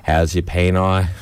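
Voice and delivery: normal talking voice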